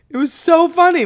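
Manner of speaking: high, loud, laughing through the words; real laughter in the voice. Real, not acted. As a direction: still cracking up while speaking. Still laughing recalling story.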